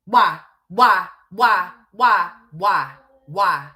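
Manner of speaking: demanding an answer from the streamer, loud and angry; loud repeated why. Real, not acted. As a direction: fire the word why again and again.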